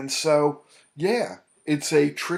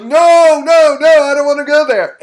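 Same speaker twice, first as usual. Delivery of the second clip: much higher, louder mock-frightened refusal; refusing client; 18 dB louder than usual. Client refusing to go back to his anxiety, high squeal. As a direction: squeal a panicked no. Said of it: "He's like".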